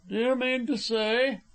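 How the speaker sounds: high, pushing challenge; bully him with the question; blustering, pitched well up. A narrator's reading. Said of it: "in a bullying tone".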